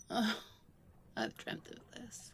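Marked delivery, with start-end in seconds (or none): 1.1-2.3 low, soft whispered confession. Acted. Confessing to partner before the kiss, low whisper. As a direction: breathe it as a secret.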